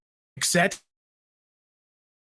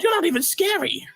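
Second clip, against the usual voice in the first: high mocking taunt. Mocking clown.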